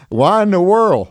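disbelieving why, slow and loud; amazed why in the world; incredulous. Real, not acted. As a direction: ask it like you can't believe it. Host incredulous at atheists inviting him.

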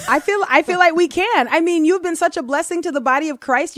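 eager, rising praise for the guest; excited. Real, not acted. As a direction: pile on the enthusiasm. Hyping guest's grand title.

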